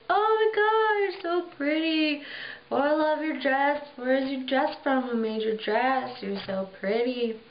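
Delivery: high, breathless gushing over her dress; gushing admirers. Retold or imitated. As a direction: fawn over her like starstruck party guests. Party guests gushing over her.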